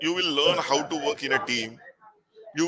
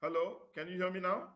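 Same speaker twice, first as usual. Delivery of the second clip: very faint, far off the mic; faint, hard-to-hear voice; barely audible; 3 semitones higher, 14 dB quieter than usual. Real, not acted. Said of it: "faint voice".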